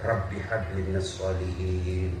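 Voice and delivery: low, soft prayer into the ear; whispered prayer. Acted. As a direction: murmur it into a sleeping child's ear. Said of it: "bisik telinganya".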